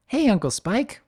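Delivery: put-on high, bright young filly calling out; filly's shout. Young filly calling out to Uncle Spike, high and eager. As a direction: shout a cheerful hello like a little filly. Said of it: "the leader of the gang shouts out".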